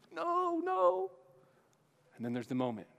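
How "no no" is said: much higher, whiny protest; high Smeagol pleading no. Acted. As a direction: squeak it out in denial. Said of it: "Smeagol answering Gollum".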